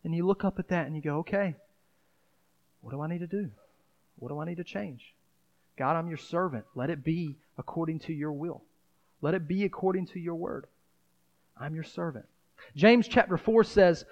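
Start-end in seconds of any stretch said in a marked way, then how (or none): 1.2-12.3 softer, hushed words of surrender; a quiet, yielding prayer to God; soft humble prayer. Retold or imitated. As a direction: pray it quietly like a humble servant.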